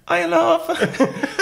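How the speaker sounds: high squeak of nervous greeting; broken, peeping voice. Retold or imitated. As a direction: peep up through a cracking voice. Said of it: "peeped up through a broken voice".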